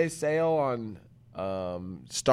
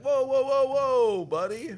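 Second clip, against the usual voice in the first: high, louder whoa from a scared bird; mock alarmed bird; 13 semitones higher, 5 dB louder, slower than usual. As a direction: bird throws up its wings in alarm. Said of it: "he's like".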